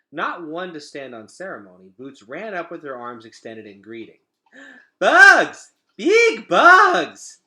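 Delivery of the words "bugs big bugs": put-on much higher and much louder, a little kid squealing with delight; excited toddler cry. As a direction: shriek it like a thrilled toddler. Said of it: "she cried".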